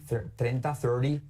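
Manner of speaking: ordinary talk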